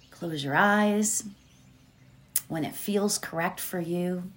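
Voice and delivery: soft, calm meditation voice; guided meditation. Acted. Healer guiding her audience into meditation, calm and soft. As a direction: ease listeners gently into stillness.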